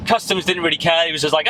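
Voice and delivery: ordinary delivery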